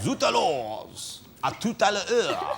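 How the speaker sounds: put-on drawn-out comedy French accent; Pascal Sauvage. Host mimics the film's French villain, comedy French accent. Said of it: "He did all that, right?".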